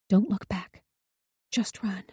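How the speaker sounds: urgent whisper. A narrator's reading. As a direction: whisper it so he can't hear. Whispering to sister.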